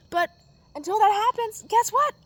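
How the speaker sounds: rising to a defiant punchline; indignant. Real, not acted. Venting about mother.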